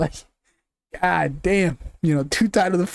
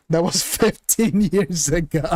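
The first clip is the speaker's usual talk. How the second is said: laughing. Real, not acted. Laughing at old title defenses.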